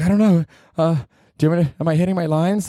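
high, fast flustered actress voice; fluttery and unsure, rushing the question; ditzy actress. Retold or imitated. Actress asking crew in space, high and flustered. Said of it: "They're like".

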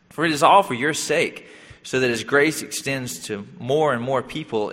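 ordinary delivery

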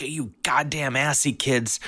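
high, slow cranky scolding; cranky mom. Retold or imitated. Mom scolding the kids, slow cranky. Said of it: "my mom's complaining".

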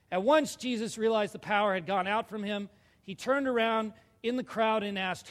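loud, measured Bible reading from the pulpit; scripture reading. Acted. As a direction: read it out strongly to the whole church. Reads scripture aloud to congregation.

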